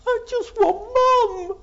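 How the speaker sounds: put-on high, loud, sobbing little girl's plea; sobbing child. Little girl sobbing for her mum to a carer. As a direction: wail it like a heartbroken little girl. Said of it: "And she sobs".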